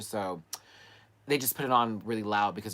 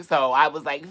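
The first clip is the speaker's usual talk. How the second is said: ordinary talk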